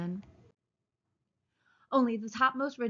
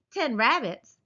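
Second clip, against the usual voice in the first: scornful; 4 semitones higher, 6 dB louder, slower than usual. A narrator's reading. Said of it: "it said scornfully".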